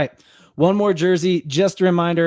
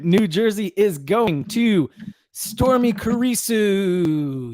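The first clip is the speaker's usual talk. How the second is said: slow, higher announcer reveal; drawn-out, higher announcement of the winner's name; 7 semitones higher, much slower than usual. Acted.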